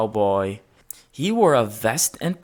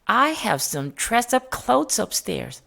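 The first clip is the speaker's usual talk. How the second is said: higher, kindly lady voice; Mrs Gray's voice; 8 semitones higher than usual. A narrator's reading. Mrs Gray offering George dress-up clothes, in a kindly voice. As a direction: voice her as a gentle older lady. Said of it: "said Missus Gray".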